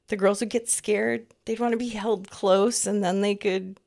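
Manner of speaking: ordinary talk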